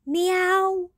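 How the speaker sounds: high, loud little "meow"; kitten meow. Acted. The kitten confessing to Emily, high meow. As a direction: mew like a guilty kitten.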